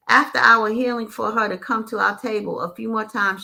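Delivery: usual speaking voice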